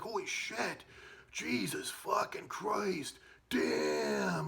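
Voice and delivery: high, slow, half-crying horrified curses; freaking out. Retold or imitated. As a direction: wail the curses as if about to cry. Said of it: "started freaking out".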